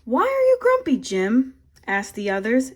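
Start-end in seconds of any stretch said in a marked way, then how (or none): 0.0-1.5 high, loud chorus of animals; high loud group question; animals asking. A narrator's reading. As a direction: call it out high as the crowd. All the animals ask together.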